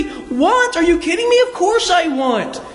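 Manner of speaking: high, incredulous mock outburst; eager acceptance. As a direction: jump at the offer, drawing out the last words. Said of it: "And you would say".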